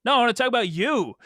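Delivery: a son cutting the man off, high and fast; high, fast, loud protest; indignant outburst. Retold or imitated. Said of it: "And he's like".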